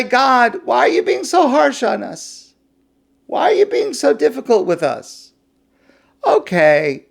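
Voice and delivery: high, loud, aggrieved complaining; whining nations. Acted. Ammon and Moab complaining to God about harsh treatment. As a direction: whine about unfair treatment.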